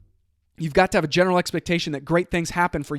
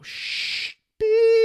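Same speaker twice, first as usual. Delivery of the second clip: self-censor beep. Shown as a demonstration. Bleeping out the boss's swear for the show, high squeak. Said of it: "That's just me, since I don't cuss on the show".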